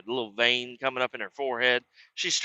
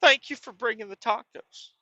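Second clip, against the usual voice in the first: lightly high thankful voice; grateful family; 10 semitones higher than usual. Retold or imitated. Family thanking the hero for tacos, lightly high grateful voice. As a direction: thank him a touch higher. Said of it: "They said".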